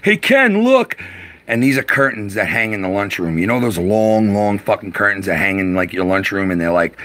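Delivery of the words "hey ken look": put-on much higher and louder kid calling out; excited kid voice. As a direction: call out like an excited boy showing off. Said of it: "Alan, go".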